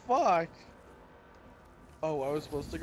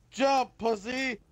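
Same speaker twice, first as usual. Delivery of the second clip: higher, slow jeering call; taunting call; 6 semitones higher, slower than usual. Real, not acted. Player daring his friend over the edge, teasing call. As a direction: egg him on to jump.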